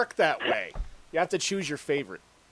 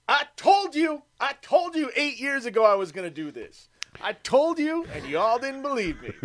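high, crowing I-told-you-so; mock triumphant speech; 10 semitones higher than usual. Imagined winner addresses doubters at acceptance speech, mock triumphant. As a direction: crow it like a vindicated winner, pitched up. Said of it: "He goes".

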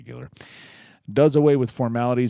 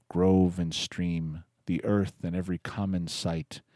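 poetry reading; 4 semitones lower, 4 dB quieter than usual. Acted. Reads Wordsworth ode aloud.

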